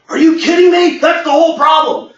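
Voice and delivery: high, loud disbelief; incredulous outburst. Retold or imitated. Owner scoffing at a consultant's plan, high and loud. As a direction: react like the idea is absurd.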